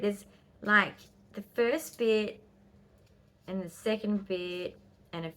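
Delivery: normal talking voice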